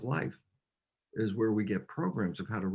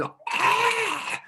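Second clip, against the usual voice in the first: sudden very high shriek of panic; mock shriek; 3 dB louder than usual. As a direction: yelp like the world is ending. Mother freaks out at snake.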